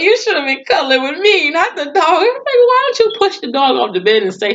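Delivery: high, loud whiny parody of him; mocking husband. Vlogger mocking the husband's whiny demand for cuddles, high and loud. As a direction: sneer at his neediness.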